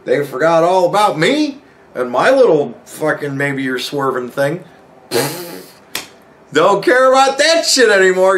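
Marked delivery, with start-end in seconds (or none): 5.1-8.4 said through a laugh, higher and louder; laughing it off while telling friends the story. Real, not acted. Telling friends about the buddy who stopped caring, laughing.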